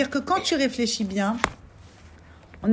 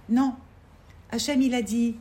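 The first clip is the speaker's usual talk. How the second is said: usual speaking voice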